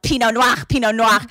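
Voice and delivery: loud, repeated German-like Pinot Noir; mock French sounding German. Friend needling Celine, German-sounding French. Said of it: "she just kept going in on her".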